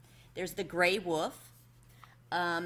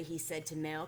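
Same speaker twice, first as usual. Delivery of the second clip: usual speaking voice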